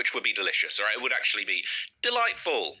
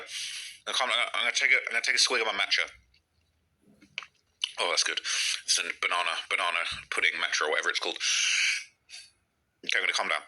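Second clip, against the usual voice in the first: calming down; 9 semitones lower than usual. Real, not acted. Sipping matcha to calm down after a rant, calmer.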